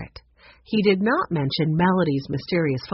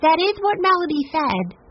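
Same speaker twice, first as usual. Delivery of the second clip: put-on high-pitched voice for young Sue Lee; lifted, girlish character reading; voiced girl character; 11 semitones higher, 3 dB louder than usual. A narrator's reading. Sue Lee confirms what Melody said, high girlish voice. Sue Lee's line in story.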